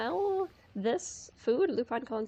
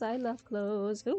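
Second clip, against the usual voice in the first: ordinary talk, nothing marked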